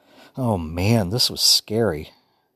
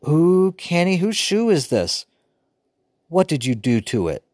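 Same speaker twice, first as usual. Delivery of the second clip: little sister voice; 9 semitones higher than usual. A narrator's reading. Joey's dialogue, little sister.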